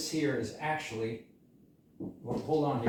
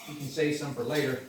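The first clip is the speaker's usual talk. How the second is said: ordinary talk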